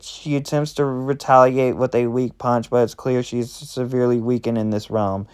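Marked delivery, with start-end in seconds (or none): none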